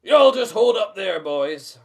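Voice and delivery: loud Southern command; strict command. A narrator's reading. Stranger ordering Shaggy and Scooby, loud Southern drawl. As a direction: stop them in their tracks. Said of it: "a strict voice commands".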